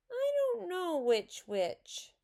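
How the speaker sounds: very high, slow whine; upset child's drawn-out complaint; tearful. A narrator's reading. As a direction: wail it helplessly to the teacher. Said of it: "said Susan tearfully".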